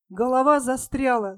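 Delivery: ordinary delivery, nothing marked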